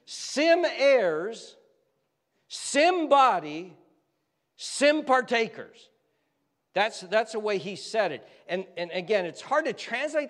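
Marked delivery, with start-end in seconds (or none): 0.0-5.9 "sim" stressed, each phrase slow, high and loud; coined words stressed. Shown as a demonstration. Preacher coining Greek-style words for the congregation, slow and emphatic. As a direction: slowly punch "sim" in each made-up word. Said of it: "I'm just gonna make up words here".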